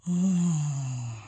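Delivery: long low sleepy yawn sigh; a big sleepy yawn. A narrator's reading. Baby yawns.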